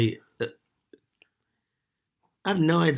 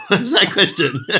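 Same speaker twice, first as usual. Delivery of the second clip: laughing; 6 semitones higher, 6 dB louder, slower than usual. Real, not acted. Both laughing at the apps.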